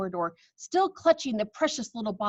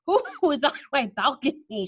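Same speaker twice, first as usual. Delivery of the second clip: muttered; 5 semitones higher, 4 dB louder than usual. Acted. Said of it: "she muttered".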